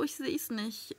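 normal talking voice

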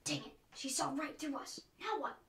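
soft, conspiratorial muttering; hushed aside. Acted. Burglar to partner after girl saw through them, hushed aside.

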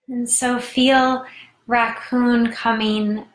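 measured meditation voice with long pauses; guided meditation. Real, not acted. Host guides listeners into tarantula meditation, unhurried guided-meditation voice. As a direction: guide them inward, leaving room to breathe.

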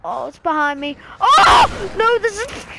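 panicked shout; yelling in fright as it attacks; being chased. Real, not acted. Creature attacking from behind.

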